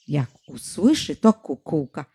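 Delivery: plain reading voice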